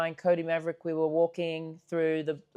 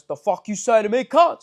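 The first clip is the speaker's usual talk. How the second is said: aggressive stranger; 3 semitones higher, 7 dB louder than usual. Retold or imitated. Stranger confronts two mates walking past, loud and hostile. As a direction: bark it out like a stranger picking a fight.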